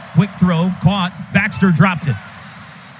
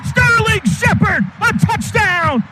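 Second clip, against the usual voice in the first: touchdown call; 8 semitones higher than usual. Real, not acted. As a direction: stretch the name out in triumph.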